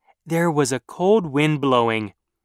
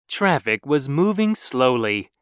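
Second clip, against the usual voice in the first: ordinary delivery, nothing marked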